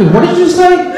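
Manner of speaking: high, loud disbelieving question; outraged double-take; shocked mom. Retold or imitated. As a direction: mom can't believe what she heard. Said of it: "And she goes".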